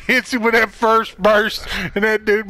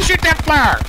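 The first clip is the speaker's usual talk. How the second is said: louder, pressing call to shoot; urgent call; 4 semitones higher, 5 dB louder than usual. Real, not acted. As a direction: push him to fire now. Urging teammate during firefight.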